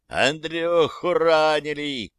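urgent yell from nearby; man down shout. Acted. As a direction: call out a wounded comrade. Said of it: "заорал кто-то рядом".

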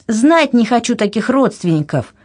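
high, indignant outburst; mild cry. A narrator's reading. Anna rejecting her relatives in a quarrel, mildly shouted. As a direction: disown them hotly, pitch lifted. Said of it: "кричала Анна".

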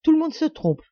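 high, loud, overly forceful denial; a protest pitched high and too emphatic; too much energy. A narrator's reading. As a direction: deny it far too hard. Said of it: "dit-elle avec trop d'énergie".

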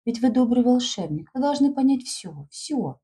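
put-on high, eager girl pleading; girl's pleading voice. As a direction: urge him like a trusting schoolgirl. Girl pleading with her friend.

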